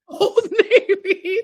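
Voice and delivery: laughing. Real, not acted. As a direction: squeeze it out while laughing. Laughing at the misheard name.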